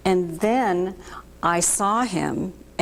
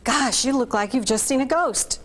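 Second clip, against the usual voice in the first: husband's alarm; 5 semitones higher than usual. Retold or imitated. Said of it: "he goes".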